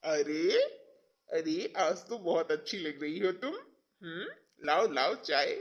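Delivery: soft, low flirtatious murmur; sweet-talking.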